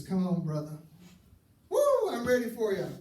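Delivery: squaring up for a fight, hammed up; mock fight ready. [name] daring Bill to argue, loud mock challenge.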